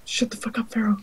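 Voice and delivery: hushed, unvoiced telling-off; whispered aside. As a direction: whisper it under your breath. Muttered aside during another's speech.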